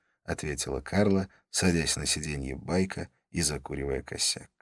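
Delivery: ordinary delivery, nothing marked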